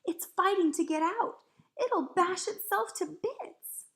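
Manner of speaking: high, loud and agitated; alarmed cry. A narrator's reading. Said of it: "Sophie cried".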